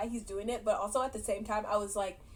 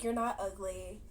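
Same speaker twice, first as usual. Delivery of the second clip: tender reassurance; 3 semitones lower, 7 dB quieter than usual. Retold or imitated. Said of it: "he's like".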